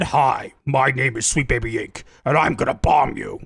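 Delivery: low, cartoonish villain voice with a laugh; hammed-up low caller voice; mock bomb-threat caller.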